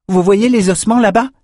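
high, loud, eager question; Chardon crying out. A narrator's reading. Pointing out bones to companions, high excited cry. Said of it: "s'écria Chardon".

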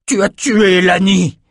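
accusation hurlée, plus aiguë et plus forte; rugissement furieux. A narrator's reading. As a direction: rugis ta rage contre la meurtrière. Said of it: "rugit-il". (Ren accuse la magicienne, rugissement furieux)

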